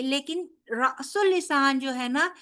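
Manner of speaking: ordinary talk, nothing marked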